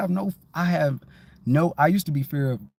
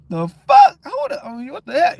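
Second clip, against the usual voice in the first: much higher, loud startled yelp; hammed-up shocked cry; startled outburst; 13 semitones higher, 7 dB louder than usual. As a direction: jump back in shock at something strange. Acts out startled reaction.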